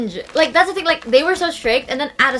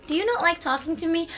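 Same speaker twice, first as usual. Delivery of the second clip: softer, higher imitated question; gently voiced hurt mom; sensitive, wounded; 4 semitones higher, 5 dB quieter than usual. Mom asks her silent child, mildly wounded. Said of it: "she is a bit more, like, sensitive".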